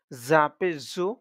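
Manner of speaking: "জু" as a buzzing, whistled Z; whistling buzz. Shown as a demonstration. Teacher contrasting four similar letters, "জু" as a whistling Z. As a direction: keep the tongue in and whistle the Z of "জা পেশ জু". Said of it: "আওয়াজটা শিস শিস দিয়ে".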